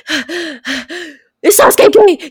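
high, loud breathless shouting; frantic interviewer. Pretend. Podcaster acting out ambushing fans at conventions, frantic loud. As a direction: rush at a stranger, out of breath. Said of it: "being like".

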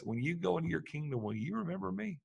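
soft, lifted plea to be remembered; gentle request. Retold or imitated. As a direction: ask it quietly, humbly. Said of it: "And then he says".

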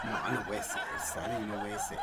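laughing disbelief. Real, not acted. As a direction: laughs while refusing to believe it.

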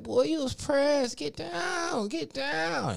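slow, very high, loud mock yell; imitated yelling. Imitating drunk woman yelling at the dog, loud high holler. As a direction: holler it high, dragging out each word. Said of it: "And she's yelling".

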